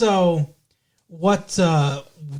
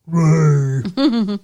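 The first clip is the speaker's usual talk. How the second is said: a hint of Ray Romano, louder, calling out the name; lightly voiced sitcom husband calling his brother; 4 dB louder than usual. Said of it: "now you sound like Ray".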